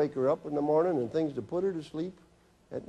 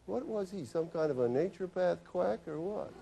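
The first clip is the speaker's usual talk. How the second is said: a hint of a sneering mother, pitched higher and softer; mock the doctor behind his back, lightly voiced; scornful patient; 6 semitones higher, 4 dB quieter than usual. Mother leaving the office, lightly mocking the doctor.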